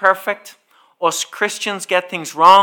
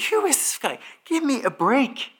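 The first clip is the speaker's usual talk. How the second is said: exasperated muttered thought; 8 semitones higher, 6 dB quieter than usual. Retold or imitated. Inner reaction to the car park man.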